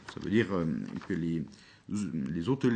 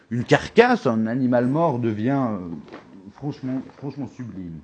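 dramatic emphasis; 3 semitones higher, 6 dB louder than usual. Acted.

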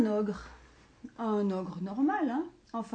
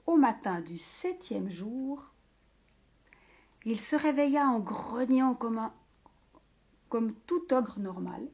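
ordinary delivery, nothing marked

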